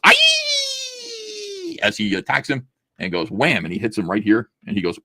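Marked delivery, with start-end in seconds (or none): 0.0-1.8 high, hammed-up war yell; a sudden comic war cry; mock battle cry. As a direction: yell the charge like a cartoon fighter.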